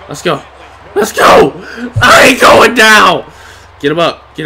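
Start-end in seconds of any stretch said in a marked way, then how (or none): 0.9-1.5 high, loud battle cry; real pumped-up holler; rallying shout. Real, not acted. Rallying in game, second take. 2.0-3.3 loud, raised-pitch declaration; real fired-up holler; defiant shout. Real, not acted. Refusing to lose.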